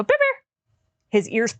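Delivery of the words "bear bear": much higher, sing-song call of the dog's name. Shown as a demonstration. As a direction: call him brightly so his ears perk up. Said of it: "and I go".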